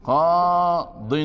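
قاض drawn out very slowly as "qaa-din"; demonstrated tanween. Shown as a demonstration. Teacher showing the word without the article, demonstrated tanween. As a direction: stretch the long aa in قاض, then land on "-din". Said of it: "ستقول".

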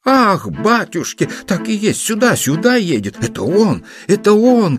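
high-pitched, overjoyed flustered cries; a mother's high, agitated exclamations; excited cry. A narrator's reading. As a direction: cry out in a flurry as the long-awaited one arrives. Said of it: "кричала Анна Павловна".

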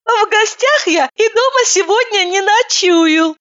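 high, teary, slow phone voice; a weepy woman picking up the phone; tearful high woman. A narrator's reading.

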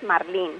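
"marlín" stressed on the final LÍN; stress on last syllable. Shown as a demonstration. Expert giving the correct pronunciation, final-stressed "marlín". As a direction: put the weight on LÍN in "marlín". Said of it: "Con énfasis en la última sílaba".

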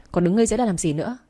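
plain reading voice

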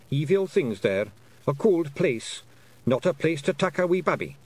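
high Scottish voice of warning; warning pictsie. A narrator's reading. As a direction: warn her in spaced Scots phrases. Feegle warns of the other side.

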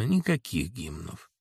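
тихо и низко, спокойно; откажи ровно и спокойно. Acted. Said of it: "сказал спокойно". (спокойный тихий отказ)

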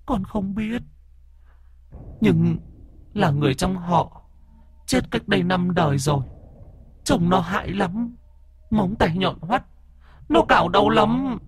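put-on a scared dead girl warning of the demon; ghost girl's voice. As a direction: beg the master in a thin high child's voice. Ghost girl answers the master.

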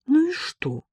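high-pitched, insolent retort; defiant, cheeky. A narrator's reading. Ella shrugs off her shocked friend, defiantly. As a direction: toss it back cheekily. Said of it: "ответила Элла дерзко".

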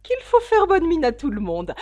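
a lofty duchess pronouncing her moral. Acted. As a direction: lecture Alice like a pompous duchess.

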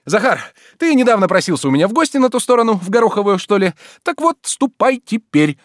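high, loud, agitated urging; feverish agitation. A narrator's reading. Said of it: "с лихорадочным волнением говорил Обломов". (с лихорадочным волнением)

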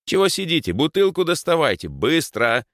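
high, impatient, sharp command; urgent order. Acted. Rower orders friends to get a bottle, urgently. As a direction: rush them into action.

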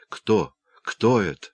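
usual speaking voice

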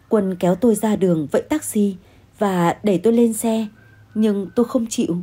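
plain reading voice

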